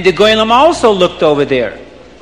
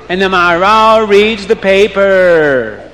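usual speaking voice

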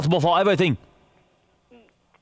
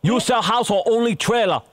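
put-on high-pitched accented questioning; indignant challenge; 6 semitones higher than usual. Prank caller challenges agent's credentials.

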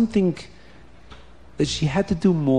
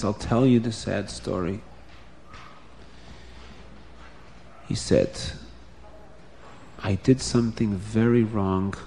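lower, softer sorrowful start; subdued; 6 semitones lower, 8 dB quieter than usual. Acted. The sheikhet to Bubby, low and subdued. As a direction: admit the wrong quietly. Sheikhet begins sad story.

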